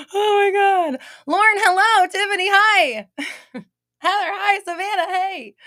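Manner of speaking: high, loud squealing hellos; thrilled to see regulars arrive, squealing hellos; excited greetings. Real, not acted. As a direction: greet each friend with a squeal.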